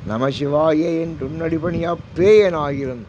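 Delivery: drawn-out devotional intoning; slow verse recitation. Acted. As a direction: stretch each word of the hymn slowly. (மெதுவாக பாடல் ஓதுதல்)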